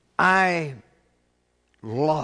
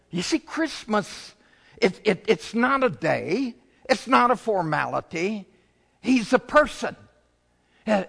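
full-force preaching; 7 semitones higher than usual. Acted. Pastor rousing the congregation, full preaching voice. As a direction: preach it out with rising fire.

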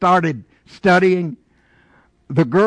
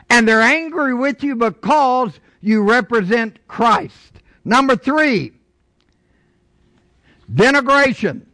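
raised, higher pulpit voice; emphatic preaching; 5 semitones higher, 3 dB louder than usual. Real, not acted. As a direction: drive each phrase home to the congregation. Preaches at full force.

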